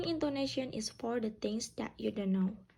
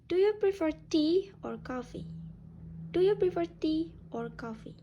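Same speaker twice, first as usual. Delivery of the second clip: raised pitch through "tea", dropping on "coffee"; choice-question intonation; 4 semitones higher, 3 dB louder than usual. Shown as a demonstration. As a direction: lift "tea", then let "coffee" fall. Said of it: "intonation can drops on the second option".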